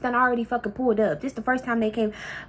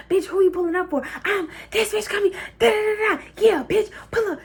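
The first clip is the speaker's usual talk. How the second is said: high-pitched taunting challenge; heated; 8 semitones higher than usual. Real, not acted. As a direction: dare her to come, fired up. Heated reply to auntie's threat.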